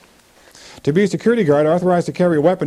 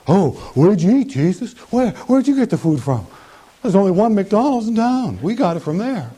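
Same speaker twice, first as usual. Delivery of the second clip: nosy disciples; 5 semitones higher, 3 dB louder than usual. Acted. Disciples questioning Jesus.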